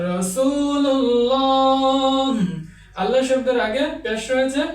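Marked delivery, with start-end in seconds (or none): 0.1-2.3 রাসূলুল্লাহ chanted slowly with a thick, heavy lam. Shown as a demonstration. Quran teacher chanting রাসূলুল্লাহ slowly with a heavy lam. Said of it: "এভাবে উচ্চারণ করতে হবে".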